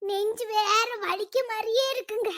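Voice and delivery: soft, feeble complaint; weak and ailing. Acted. Sick wife lists symptoms to husband, weak and soft. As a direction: plead quietly as if too sick to talk.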